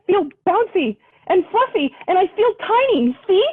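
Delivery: high, eager little bear voice; breathless, pleading to be small; gasping Bunny Bear. Acted. Bunny Bear begging the bunnies, high and eager. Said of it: "Bunny Bear gasped".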